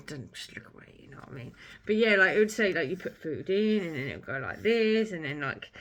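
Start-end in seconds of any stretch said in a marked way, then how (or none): 0.1-1.5 soft unvoiced whisper. Real, not acted. Whispering to squeamish viewers to look away. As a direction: hush it to the squeamish. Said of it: "if you're screaming, just look away".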